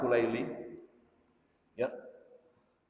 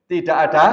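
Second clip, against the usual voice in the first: TIDAK ADA hammered out much louder; emphatic repeat; 9 dB louder than usual. Real, not acted. Preacher repeats his ruling to the congregation, emphatic. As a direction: repeat "Tidak ada" loudly and firmly, then stop. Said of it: "Saya ulang, ya".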